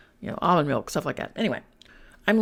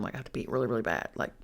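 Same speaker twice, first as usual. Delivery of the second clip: ordinary talk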